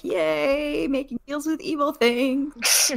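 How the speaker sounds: high, slow singsong hooray; drawn-out pretend glee; mock cheer. Acting out Tiamat's approval.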